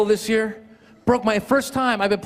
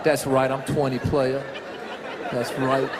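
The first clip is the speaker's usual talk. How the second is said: lower, laid-back dude voice; imitating a cool twenty-year-old fan, low and laid-back; 7 semitones lower, 4 dB quieter, slower than usual. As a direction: play it super cool and easy. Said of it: "She's like this".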